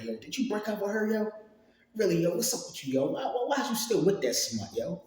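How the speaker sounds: high-pitched mock disbelief; imitated incredulous coworkers. Coworkers retold reacting in disbelief.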